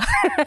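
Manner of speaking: brief self-amused giggle; quick laugh. Real, not acted. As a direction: laugh at the silly moment. Laughs after finishing a series.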